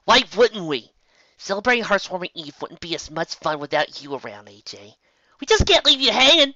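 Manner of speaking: loud, high tomboy friend; Rainbow's brash voice. A narrator's reading. Rainbow reassuring Applejack with a shrug, brash. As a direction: toss it off with a shrug. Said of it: "Rainbow said, shrugging".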